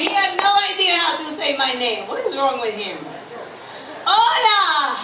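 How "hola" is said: bright loud greeting. Shown as a demonstration. Comic greets the crowd with a loud, laughing hola. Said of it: "I know you can do better".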